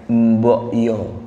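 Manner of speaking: low, slow Javanese "Mbok yo" with a falling end; Javanese idiom. Shown as a demonstration. Teaching Jakarta listeners a Javanese idiom, Javanese lilt. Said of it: "Misalnya ya".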